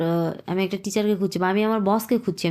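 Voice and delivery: ordinary delivery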